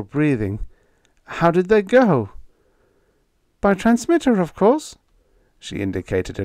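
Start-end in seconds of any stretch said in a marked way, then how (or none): 1.2-5.0 put-on young woman voice. A narrator's reading. Vina's voice continues.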